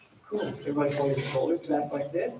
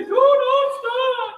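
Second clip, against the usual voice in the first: very high and slow; high demo; 8 dB louder, much slower than usual. Shown as a demonstration.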